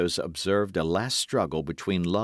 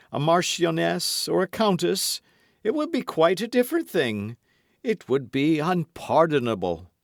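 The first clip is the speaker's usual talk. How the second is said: lightly voiced swaggering musketeer, raised pitch; Porthos voiced; 8 semitones higher, 4 dB louder than usual. A narrator's reading. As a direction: play Porthos pleading with mock hurt.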